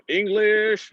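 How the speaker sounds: high, drawn-out hopeful question; acted language-barrier question; tentative asking. Trying languages on puzzled Italian strangers.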